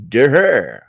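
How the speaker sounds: loud, drawn-out "duh"; a big, obvious duh; mocking duh. Real, not acted. Host scoffs at the article on sovereignty, mocking duh. Scornful reaction to the news.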